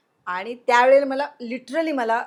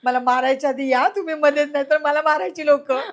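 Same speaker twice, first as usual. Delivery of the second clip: laughing while pleading; 4 semitones higher, 6 dB louder than usual. Real, not acted. Laughing plea retold.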